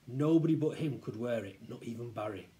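plain reading voice

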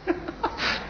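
very high, breathy little giggle; a quick high giggle before the answer. Real, not acted. Laughs before giving the answer. (尖声轻笑)

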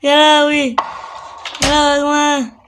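frightened gamer crying out to his viewers; got a fright. Real, not acted. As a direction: blurt it out breathless with real fright. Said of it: "اتخضيت".

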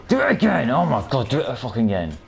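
high, loud shouted dare; furious taunt. Real, not acted. Gamer daring the enemy to leap again, furious high shout. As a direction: scream the dare at him.

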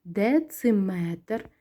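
дециметр drawn out slowly, syllable by syllable; slow, careful dictation of дециметр; slow checking dictation. Shown as a demonstration. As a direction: read дециметр slowly, each syllable separated. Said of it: "перевіряємо".

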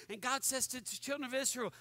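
ordinary delivery

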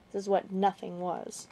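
usual speaking voice

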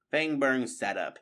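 plain reading voice

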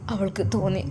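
usual speaking voice

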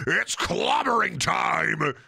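slow, hammed-up hero shout; superhero battle cry. Recalling smashing toy gloves as a kid, mock hero shout. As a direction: bellow like a comic-book brute. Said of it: "smash them together and go".

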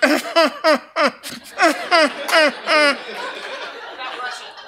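high, hammed-up movie-villain cackle; mock German villain laugh. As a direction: cackle like a Raiders of the Lost Ark villain. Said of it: "all to laugh in".

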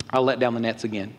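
low, reluctant compliance; unenthusiastic. Acted. Peter answers Jesus about the nets, unenthusiastic. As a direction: agree with no excitement at all. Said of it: "Does he sound enthusiastic?".

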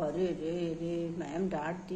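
very soft, low and slow commiseration; cooing sympathy. Acted. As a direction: murmur pityingly to a hurt child. Commiserating with the scolded child. (बहुत धीमे, पुचकारते हुए)

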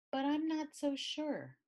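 put-on a little kid daring to hope; hopeful child. A narrator's reading. Child disagreeing with her sister, hopeful.

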